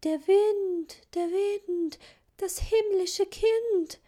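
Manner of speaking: high, slow, scared little voices; frightened children. A narrator's reading. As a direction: answer like timid, startled children. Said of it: "Erschrocken antworteten die Kinder".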